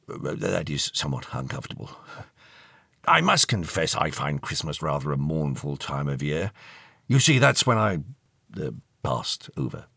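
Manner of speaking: put-on low, shivering, stuffy old gentleman; deep elderly voice, haltingly melancholy; mournful old ghost.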